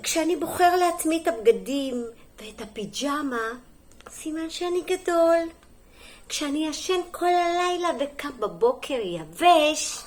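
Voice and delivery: lively, sing-song picture-book reading; story reading to children. Acted. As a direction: read it clearly to small children, lifting the key words. (הקראת סיפור לילדים)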